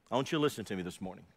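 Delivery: ordinary talk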